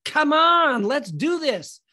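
loud, high rallying cry; rally a nervous friend with bravado; mock courageous. George urging his friend to go streaking, mock courageous. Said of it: "becomes Mister Courageous and he says".

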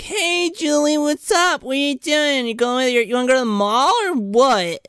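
high, chirpy put-on teenager; mock teen friends. Friends invite Julie to the mall, mock-peppy high voice. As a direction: pitch it way up as an imitated pal.

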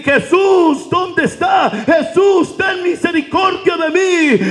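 high, desperate shout; crying out. Acted. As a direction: call out to be heard over the crowd. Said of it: "empezó a clamar". (el ciego clama a gritos a Jesús)

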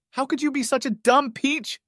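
high-pitched indignant outburst, not truly shouted; mock angry yell. A narrator's reading. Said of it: "she yelled".

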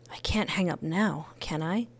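hushed panicky whisper. Real, not acted. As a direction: whisper it to yourself in dread. Panicked inner thought.

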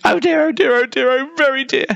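very high, anxious little voice; high-pitched worried bear; fretting polar bear. A narrator's reading. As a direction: fret to yourself, high and tight. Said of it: "said the polar bear".

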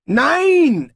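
high, loud shouted no; loud cry. Acted. Many voices shout at the king's joke, loud cry. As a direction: cry out in alarm. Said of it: "ertönte es aus mehreren Kehlen und besonders laut".

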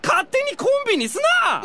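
indignant shout. Acted. Boy snaps at his friend for calling them a comedy duo, shouting. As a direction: shout it down, fed up with the joke.

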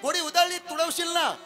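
put-on high, loud shout to the girl; shouting warning. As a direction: yell at her to get out of the way. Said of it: "ओरडत होते".

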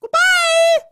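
very high, cutesy, tearful squeal; Hello Kitty squeal. Acted. Hello Kitty sobs goodbye at the ugly mom, very high squeal. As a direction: squeal it like a horrified cartoon kitten.

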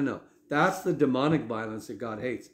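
normal talking voice

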